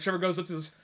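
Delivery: usual speaking voice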